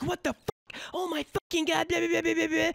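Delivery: a hint of a berserk customer; mock Karen screaming. Narrator imitates Karen cursing the cashier out, high mock scream. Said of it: "starts screaming at the top of her lungs".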